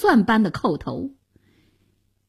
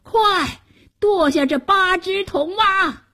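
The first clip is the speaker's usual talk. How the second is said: high, urgent barked order; loud shout; 10 semitones higher than usual. A narrator's reading. Anxious chancellor orders the bronze frogs chopped, high shout. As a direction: cry out a frantic command. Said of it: "高声喊道".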